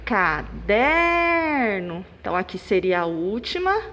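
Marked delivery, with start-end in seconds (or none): 0.0-2.0 Caderno with DER held very long; ca-DEEER-no, sustained middle syllable; stressed syllable exaggerated. Shown as a demonstration. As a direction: stretch the der of Caderno, then finish short. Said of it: "o der".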